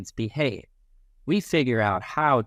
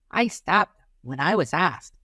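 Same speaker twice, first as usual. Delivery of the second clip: put-on child's voice; 5 semitones higher than usual. Child voice in scene.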